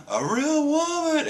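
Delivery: high gasp, then soaring awe; mock amazement. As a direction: imitate a man gasping in wonder. Sanji amazed, acted with a gasp.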